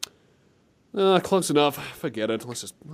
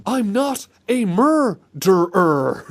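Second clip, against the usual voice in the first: loud, slow overacted denial; hammed-up denial; 4 semitones higher, 5 dB louder, much slower than usual. Rushed final take, slow and loud overacted. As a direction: stretch out the denial like a bad game actor. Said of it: "do it real quick".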